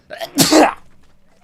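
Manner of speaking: loud sudden real sneeze; sneezing. Real, not acted. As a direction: let a big sneeze burst out. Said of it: "gonna sneeze".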